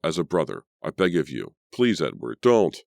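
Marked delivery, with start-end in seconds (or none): 1.7-2.8 pleading cry. Acted. Pleading brother, scene climax.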